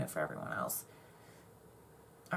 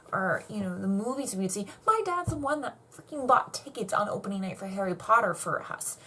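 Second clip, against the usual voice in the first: ordinary talk, nothing marked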